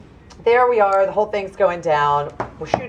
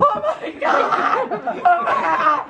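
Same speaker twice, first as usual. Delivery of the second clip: high, stretched-out laughing shriek; mock freak-out; 14 semitones higher than usual. Runner finding the gates padlocked, hammed-up freak-out. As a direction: wail in exaggerated horror. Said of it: "Freaking out".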